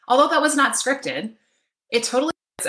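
breathless after running; panting a little, just back from a dash; out of breath. Real, not acted. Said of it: "why am I out of breath".

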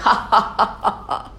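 hammed-up villain cackle; evil laugh. Kali laughing at the king, a mock evil cackle. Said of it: "Kali started laughing".

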